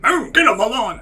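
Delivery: loud, high-pitched mock curmudgeon yell; grumpy old man. YouTuber mocking a curmudgeon chasing people away, grumpy old-man yell. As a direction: holler it as a hammed-up cranky old guy. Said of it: "the old grumpy guy".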